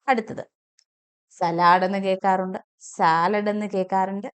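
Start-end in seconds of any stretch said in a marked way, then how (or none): 1.4-1.8 സലാഡ് as "sa-LAAD", long second vowel; common mispronunciation. Shown as a demonstration. Teacher quotes what is often heard, long second syllable. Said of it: "സലാഡ് അല്ല". 2.9-3.5 സാലഡ് as "SAA-lad"; long first vowel. Shown as a demonstration. Teacher gives the other version often heard, long first syllable. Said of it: "ദീർഘമാണ് വേണ്ടത്".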